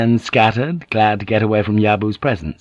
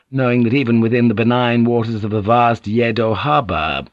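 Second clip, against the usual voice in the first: usual speaking voice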